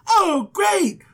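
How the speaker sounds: high-pitched mock-excited outburst; eager acceptance. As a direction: jump at the offer, hammed-up. Abram eagerly accepts the contract.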